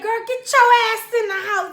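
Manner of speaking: loud, high command; retold yelled order. Boyfriend pulling girlfriend indoors, loud urgent order. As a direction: act out ordering someone inside.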